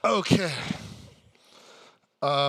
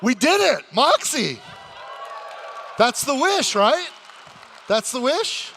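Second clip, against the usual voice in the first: excited cheer; 9 semitones higher, 6 dB louder than usual. Acted. Wish granted on stage.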